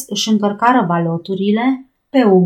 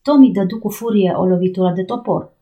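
ordinary delivery, nothing marked

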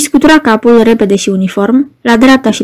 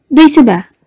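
a higher, rasping, pleading voice; hoarse plea; 4 semitones higher than usual. A narrator's reading. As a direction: beg hoarsely for water for another. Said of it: "îl rugă răgușit pe călău". (Iesua îl roagă pe călău, cu glas răgușit și rugător)

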